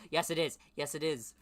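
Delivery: high, loud scornful yes it is; loud retort. Real, not acted. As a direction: snap back at the character. Sarcastic retort at character.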